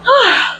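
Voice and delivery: a long, high tired sigh; sleepy sigh. Pretend. Reader pretending to get ready for bed, sighing sleepily. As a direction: let out a drowsy sigh before bed.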